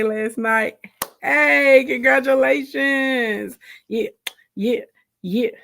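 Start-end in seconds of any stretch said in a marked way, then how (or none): none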